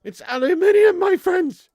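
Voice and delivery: high, loud, insistent; emphatic correction. Shown as a demonstration. Streamer correcting his friends on aluminium, loudly emphatic. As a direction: ring out the correct word indignantly.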